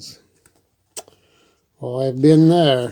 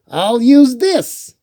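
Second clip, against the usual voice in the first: put-on much higher, louder, slower child's voice; Franklin with a new idea; 10 semitones higher, 6 dB louder, slower than usual. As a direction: proudly hold up the stick. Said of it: "he said".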